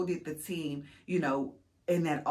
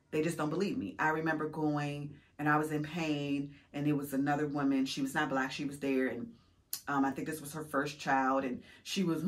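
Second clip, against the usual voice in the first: normal talking voice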